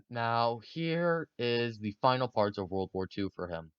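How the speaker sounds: normal talking voice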